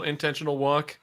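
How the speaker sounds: ordinary talk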